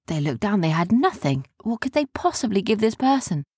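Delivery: plain reading voice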